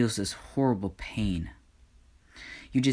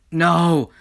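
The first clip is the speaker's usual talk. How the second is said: loud, higher desperate shout of no; yell; 8 semitones higher, 12 dB louder than usual. A narrator's reading. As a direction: scream it as the boy turns to ash. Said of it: "Izuku just yells".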